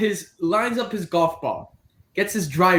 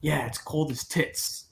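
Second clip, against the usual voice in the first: put-on low, slow, soft Boston accent; fake Boston accent; 4 semitones lower, 8 dB quieter than usual. Golfer describing New England winter, fake Boston accent. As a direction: imitate a Boston local, slowly and quietly. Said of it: "the fake Boston accent".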